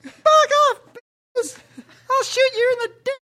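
very high squeaky puppet yelling; Elmo voice. Pretend. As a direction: shriek like an angry Muppet.

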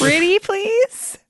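high, slow sugary begging; pretty please. Acted. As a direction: drag it out like a wheedling kid. Begging the guard again.

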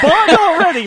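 loud laughing cheer; laughing shout. Real, not acted. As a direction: yell it through laughter. Friends egging on loudly.

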